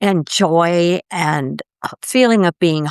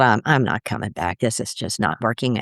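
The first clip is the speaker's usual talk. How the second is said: muttered to self; 8 semitones lower, 5 dB quieter than usual. Retold or imitated. Retold inner decision to quit.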